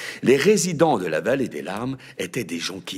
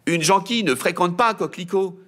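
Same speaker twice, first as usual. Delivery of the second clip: high, loud brat voice; loud high kid butting in; cheeky boy; 11 semitones higher, 5 dB louder, faster than usual. A narrator's reading. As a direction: outbid your sister, loud and high. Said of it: "surenchérit Mimosa".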